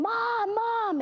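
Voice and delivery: high calling out; frantic call for mom; yelling for mom. Retold or imitated. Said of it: "my sister was, like, yelling".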